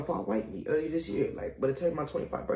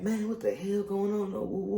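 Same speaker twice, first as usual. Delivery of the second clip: higher-pitched baffled protest; retold bewilderment; 7 semitones higher than usual. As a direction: act out being thrown by it all. Reenacts reaction to hospital tests.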